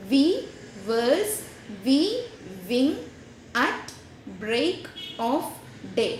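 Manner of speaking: "We verse, we wing at break of day" read very slowly with a pause after each syllable. Shown as a demonstration. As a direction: space out every beat of the verse line, very slow. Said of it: "ഒന്നും കൂടെ പറയാം". (ഓരോ സിലബിളും നിർത്തി വളരെ പതുക്കെ)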